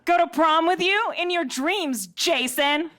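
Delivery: lightly voiced high school mean girl. Mean girl turning down Jason's prom invite, sneering. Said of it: "a mean girl from a high school movie".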